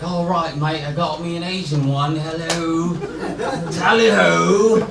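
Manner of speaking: exaggerated English accent slipping into Australian; mock English-Australian accent. Comic imitating his English friend behind his back, mock English-Australian accent. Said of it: "the accent you were doing was clearly Australian".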